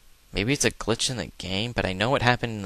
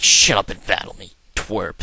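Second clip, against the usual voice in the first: mock rude retort; 5 dB louder than usual. Snaps back at the NPC.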